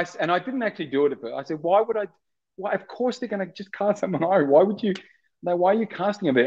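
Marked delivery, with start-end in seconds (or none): 1.6-6.5 higher-pitched incredulous objection; retold protest. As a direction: protest as if it makes no sense. Retelling own protest at audition.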